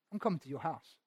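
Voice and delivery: soft, hushed, lightly voiced Jesus; tender promise. Jesus tenderly telling Zacchaeus he is coming, softly. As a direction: say it quietly as a heartfelt gift. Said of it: "wasn't just like".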